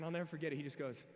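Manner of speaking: ordinary talk, nothing marked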